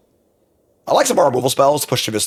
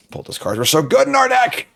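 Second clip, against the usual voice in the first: exasperated whine; 14 semitones higher than usual. Real, not acted. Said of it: "so I am pissed".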